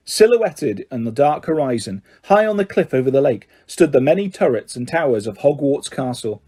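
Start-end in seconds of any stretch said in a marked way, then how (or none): none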